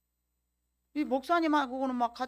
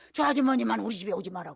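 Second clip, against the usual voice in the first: loud retold order to keep away; acted refusal; 7 dB louder than usual. Possessed villager keeping the preacher out of the house, loud mock command. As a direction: mimic a frightened person barring a visitor, loudly. Possessed person barring the speaker from entering.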